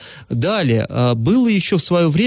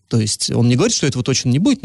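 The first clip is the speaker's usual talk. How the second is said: ordinary delivery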